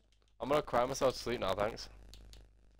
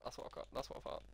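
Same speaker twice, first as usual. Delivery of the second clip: fast, breathy whispered repeat; whispered aside to himself; 16 dB quieter, faster than usual. Real, not acted. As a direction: whisper it to yourself, quick. Repeats phrase, fading to whisper.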